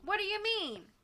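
very high-pitched indignant question; indignant interruption. A narrator's reading. Henchman interrupts his boss's boast, indignantly. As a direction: cut in, pitch shooting up. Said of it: "Bryce interrupted".

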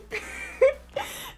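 half laugh, half cry, airless and high; teary laugh. Real, not acted. Retelling the big news, laughing through happy tears. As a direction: laugh as if about to tear up.

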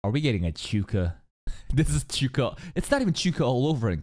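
ordinary talk

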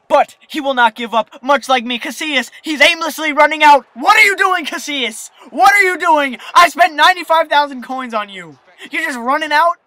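loud, high furious rant at the keeper; pissed off. Real, not acted. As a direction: berate the goalie who wasted coins. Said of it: "As you can tell, I'm pissed".

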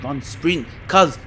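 normal talking voice

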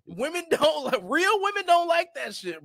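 high-pitched, emphatic exasperation; heated insistence. Real, not acted. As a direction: scold them with rising indignation. Heated rebuke of red-pill men.

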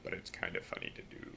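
very low, soft voice; dropped deep voice. Shown as a demonstration. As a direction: drop the voice as low as it goes. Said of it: "I can just drop my voice".

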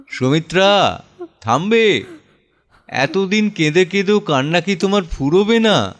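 a tired husband pleading with his weeping wife to stop; exasperated pleading. Acted. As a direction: beg her to stop, worn out and irritated. Husband begs crying wife stop.

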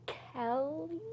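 hushed single word; soft, careful mouthing; whispering. Real, not acted. Whispers one word for guesser.

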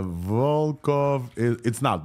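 normal talking voice

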